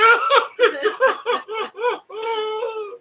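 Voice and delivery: high crying laugh, out of control; high wheezing laugh. Real, not acted. Husband wheezing with laughter beside wife, high and teary.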